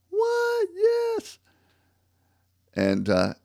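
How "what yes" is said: very high squeaky shocked voice; stunned disbelief. Retold or imitated. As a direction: squeak it out like a startled clerk. Company staff hears the news.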